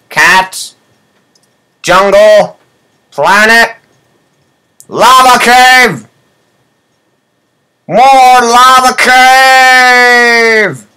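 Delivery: high, drawn-out, hammed-up announcer wail; mock excitement. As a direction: stretch it out like a fake hype reveal. Mock-excited list of levels.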